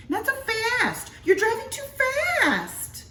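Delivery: a sharp, high wife telling him to slow down; scolding wife. A narrator's reading. Wife scolding the driving husband.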